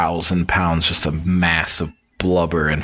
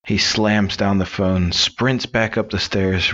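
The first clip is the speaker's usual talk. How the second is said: normal talking voice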